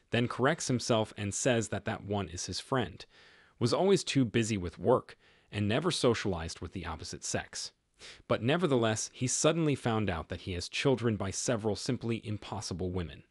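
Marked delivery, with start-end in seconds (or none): none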